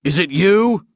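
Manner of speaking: loud, high accusing question; furious alien accusation; angry demand. Acted. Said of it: "Lars Wingetog demanded".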